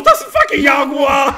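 shouting while laughing, high and loud; half-scream, half-laugh at the jaguar. Real, not acted. Gamer yelling to friend about the jaguar, laughing scream. Jaguar attack in survival game.

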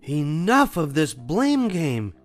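high, loud, slow scolding; angry shout. A narrator's reading. Mama scolding the cubs, angry shout. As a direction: put your foot down, loud. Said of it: "she shouted".

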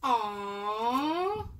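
a tearful coo over the gift; moved little aww; touched aww. Real, not acted.

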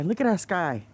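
ordinary delivery

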